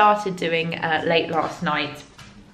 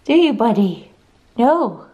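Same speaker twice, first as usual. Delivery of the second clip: higher, playful voice for a dog; teasing pet talk; 5 semitones higher than usual. Real, not acted. Owner to dog that won't fetch, teasing pet talk. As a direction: fondly tease a clueless pet.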